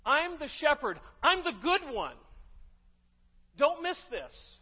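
Jesus declaring himself the one good shepherd, loud and emphatic. Pretend. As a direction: proclaim it like Jesus staking his claim. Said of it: "almost as if he's saying".

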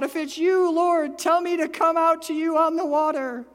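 high, loud shout across the water; Peter shouting. Retold or imitated. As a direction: call out over the storm. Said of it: "Peter shouts out".

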